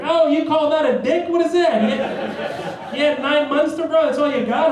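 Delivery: high, loud mocking jeer; heckling doctor. New Jersey doctor mocking a newborn, loud jeer.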